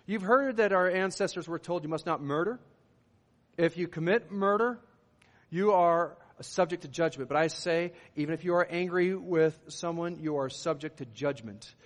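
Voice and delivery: preacher reading scripture to the congregation. Acted. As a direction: declaim the verse with weight.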